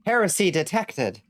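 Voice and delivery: said through a laugh, falling at the end; half-laughing, amused; laughing read. Real, not acted. Reads chat line, laughing.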